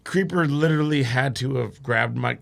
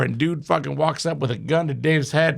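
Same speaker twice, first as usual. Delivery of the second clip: usual speaking voice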